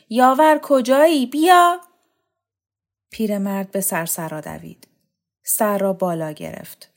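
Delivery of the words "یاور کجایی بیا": higher, louder, rising yell down the stairs; shouting for help. A narrator's reading. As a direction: scream for the servant to come. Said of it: "فریاد کشید".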